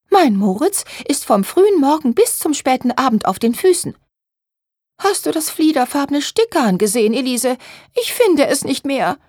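high, plaintive, fretful voice; agitated Therese. A narrator's reading. Therese defends her son while hunting for thread, agitated. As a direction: protest and fuss in a high whine. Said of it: "regte sich Therese auf".

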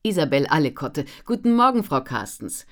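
energetic, loud, lifted greeting; full of drive and pep; dynamic editor. A narrator's reading. Editor greets Ellen on the phone, brimming with energy. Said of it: "erklang die dynamische Stimme der Lektorin".

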